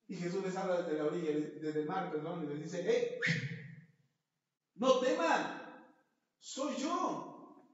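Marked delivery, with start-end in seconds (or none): 2.8-7.6 high, loud call across the water; Jesus calling to the disciples from the shore. Acted. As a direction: call out to the frightened men.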